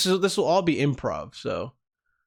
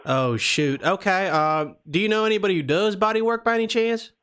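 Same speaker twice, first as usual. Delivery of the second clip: put-on Southern accent; 3 semitones higher than usual. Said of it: "we're doing the Southern accent".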